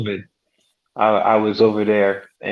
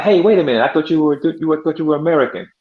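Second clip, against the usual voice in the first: surprised; 6 semitones higher, much faster than usual. Retold or imitated. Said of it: "when they start talking, it's like".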